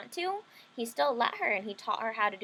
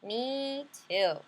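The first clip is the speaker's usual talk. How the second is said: little sister voice; 2 semitones higher than usual. Acted. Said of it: "my little sister said".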